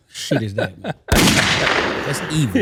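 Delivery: slow words through real laughter; laughing. Real, not acted. Reacting to his ex's petty plan, laughing slowly. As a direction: laugh it out, drawn long.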